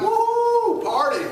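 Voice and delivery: high whoop with a laugh; whooping party. Retold or imitated. Israelites scooping up loot, whooping with joy. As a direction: celebrate like you're free.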